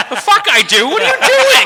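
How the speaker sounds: high, loud, laughing disbelief; cracking up while yelling at a drunk friend; incredulous yell. Retold or imitated. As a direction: shout it, half laughing in shock. Outraged retort while laughing.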